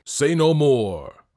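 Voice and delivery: slow, loud royal roar; booming warrior king. Acted. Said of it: "roared the king".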